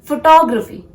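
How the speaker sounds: "photography" as fuh-TAW-gruh-fee, high TAW; "photography" with a strong TAW; correct photography. Shown as a demonstration. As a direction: punch the second syllable of "photography". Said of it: "this is photography".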